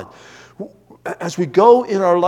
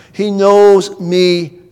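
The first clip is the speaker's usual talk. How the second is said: drawn out very slowly and louder, weight on knows; emphatic slow stress; 8 dB louder, much slower than usual. Acted. Preacher assuring his congregation, slow loud emphasis. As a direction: lean hard into each word, slowly.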